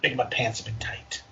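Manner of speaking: hushed joking whisper. Pretend. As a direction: whisper it like a confession. Joking aside while reading fanfic.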